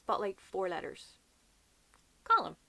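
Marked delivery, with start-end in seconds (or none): 2.2-2.6 Column as COL-um, pitched high; correct Colm. Shown as a demonstration. Repeating the name for viewers, two-syllable Column. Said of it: "Column is two syllables".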